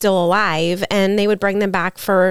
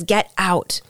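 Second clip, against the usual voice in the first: usual speaking voice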